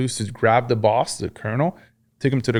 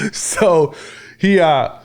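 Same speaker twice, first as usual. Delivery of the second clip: real laugh; 7 semitones higher, 3 dB louder, much slower than usual. Real, not acted. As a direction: laugh breaking into the words, pitch way up. Laughs at story of wound.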